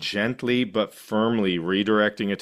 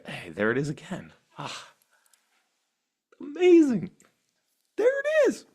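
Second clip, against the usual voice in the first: much higher, thrilled at the discovery; delighted discovery; 13 semitones higher than usual. Pretend. Meditator thrilled to catch her mind wandering. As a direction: greet the aha like a surprise gift.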